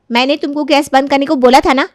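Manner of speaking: angry reproach. Acted. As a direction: confront her over the forgotten errand. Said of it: "Kaveri वहाँ से गुस्से में". (गुस्से में सवाल करते हुए)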